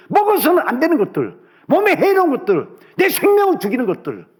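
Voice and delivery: high, forceful pulpit delivery; impassioned preaching. Real, not acted. Preacher challenging parents about harmful foods, impassioned and high.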